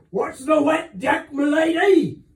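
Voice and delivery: loud high shout from right behind her. A narrator's reading. Captain shouting at Jeza from behind her, loud and high. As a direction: startle her with a sudden shout. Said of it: "he would sometimes shout".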